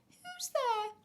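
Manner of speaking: put-on very high, loud old-lady call; granny voice. A narrator's reading. Granny answers the knock, high granny voice. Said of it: "And Granny said".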